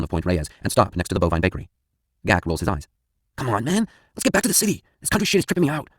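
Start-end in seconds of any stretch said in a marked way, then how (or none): 3.4-5.9 higher, put-on voice of an edgy buddy; whiny stoner friend. Gak urging his friend to head back to the city. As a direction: play Gak, spooked and itching to leave. Narrator voices Gak's complaint.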